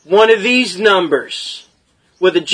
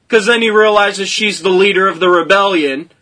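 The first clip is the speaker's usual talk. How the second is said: ordinary talk, nothing marked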